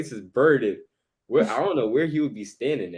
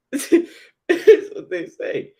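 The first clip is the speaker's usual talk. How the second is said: much higher, slow laughing through the words; 7 dB louder, much slower than usual. Real, not acted. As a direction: laugh it out in disbelief. Repeats phrase, now laughing.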